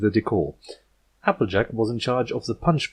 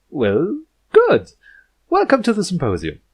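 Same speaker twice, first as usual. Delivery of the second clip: put-on much higher and louder, eager young princess; bright princess voice; 9 semitones higher, 6 dB louder than usual. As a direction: gush a welcome with a winning smile. Twilight musters vivaciousness.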